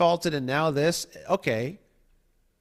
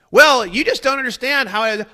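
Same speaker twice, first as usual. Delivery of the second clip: higher and louder, dismissive sing-song; smug evasive purist; 8 semitones higher, 7 dB louder than usual. Retold or imitated. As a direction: brush off the question condescendingly. Purist evading again.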